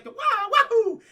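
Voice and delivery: high, loud cartoon whoop; Mario jump cry. Showing the game's kind of jump, high mock Mario whoop. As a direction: whoop it like a video game jump.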